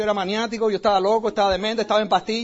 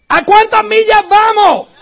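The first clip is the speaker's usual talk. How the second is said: loud, higher, slow shouted question; shouting; 8 semitones higher, 10 dB louder, slower than usual. Retold or imitated. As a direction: yell it across the moving bus. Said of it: "Y le grito a Eddie".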